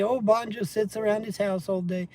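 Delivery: a light high whine of mockery; mocking trolls. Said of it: "The trolls say".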